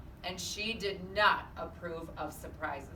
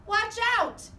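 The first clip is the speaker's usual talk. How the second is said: very high, loud cry of alarm; sharp warning; 14 semitones higher, 9 dB louder than usual. A narrator's reading. Eugenia warning the others about the pig, high and loud. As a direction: warn them fast.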